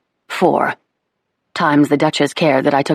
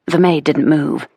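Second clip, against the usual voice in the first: ordinary delivery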